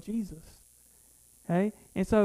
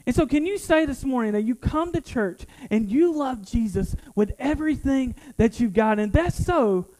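loud, higher fervent preaching; 5 semitones higher, 5 dB louder than usual. Acted. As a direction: preach to the congregation with full fire. Sermon climax.

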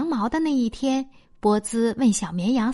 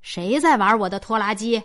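lightly voiced cross farmer; mild mock shout; farmer calling out; 3 semitones lower than usual. A narrator's reading. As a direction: call out as a farmer demanding to know who's meddling. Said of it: "农夫喊着跑过来".

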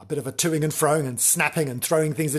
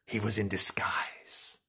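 hushed whisper; whispered secret; 4 semitones lower, 8 dB quieter than usual. Acted. Teller hushes to reveal the disguise, whispering.

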